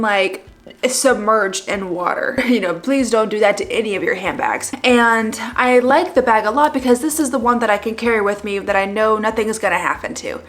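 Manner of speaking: normal talking voice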